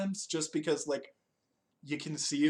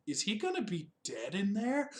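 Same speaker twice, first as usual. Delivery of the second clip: incredulous question; 5 semitones higher than usual. Retold or imitated. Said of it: "I was like".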